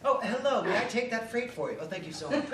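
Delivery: mock refined dock worker offering to take the freight; genteel dock worker. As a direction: play the rough worker as a well-mannered gentleman. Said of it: "a dock worker be like".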